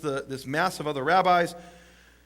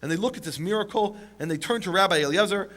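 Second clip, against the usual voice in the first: usual speaking voice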